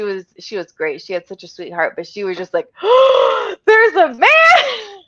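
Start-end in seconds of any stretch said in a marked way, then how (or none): 2.7-3.6 sharp, high mock gasp; shocked gasp. Babysitter spots a man in women's house, shocked gasp. 3.7-5.0 high, drawn-out scandalized cry; hammed-up horror at an intruder; mock shocked shriek. Babysitter spots a man in women's house, shocked shriek.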